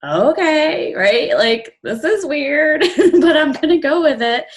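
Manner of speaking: high, light amused self-talk; a giggly okay-fine shrug; bemused inner voice. Retold or imitated. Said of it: "And I thought".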